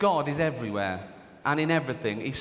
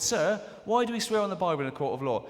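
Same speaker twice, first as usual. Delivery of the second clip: schoolkid; 4 semitones higher than usual. Retold or imitated. Kid voiced asking teacher.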